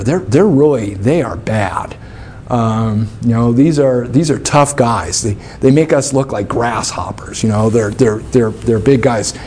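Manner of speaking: low, hushed and grim, warning of giants; ominous spies' report. Retold or imitated. Moses' spies warning Israel of giants, low and ominous. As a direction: talk them down like the enemy is terrifying. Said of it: "the spies come back and they say".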